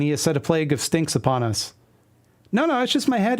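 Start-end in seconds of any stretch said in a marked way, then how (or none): 2.5-3.4 high character voice. Acted. Comic character line read aloud.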